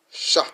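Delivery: "Sha" with SH as in she; teacher demonstrating the syllable "Sha"; SH sound. Shown as a demonstration. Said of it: "It makes a S H sound as in she".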